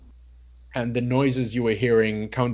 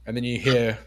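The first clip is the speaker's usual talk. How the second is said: ordinary delivery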